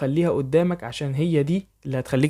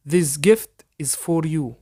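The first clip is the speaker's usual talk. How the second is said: very slow, careful English for learners to copy; slow dictation pace; slow model sentence; much slower than usual. Shown as a demonstration. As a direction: spell it out slowly so students can repeat. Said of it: "حاول تقلدني".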